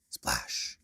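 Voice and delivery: breathy whispered splash sound; hushed splash effect. Acted.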